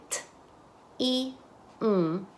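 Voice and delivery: "t, i, m" as three isolated sounds, paused between. Shown as a demonstration. Teacher segments tim into phonemes, sound by sound. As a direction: sound out "t, i, m" one at a time. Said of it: "y después dicen".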